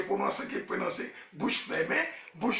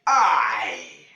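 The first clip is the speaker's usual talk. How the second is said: I shouted out loud and lower; 8 semitones lower, 7 dB louder than usual. Real, not acted. Wife yells the letter I at the lesson. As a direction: belt the letter I out. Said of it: "Ah, bon".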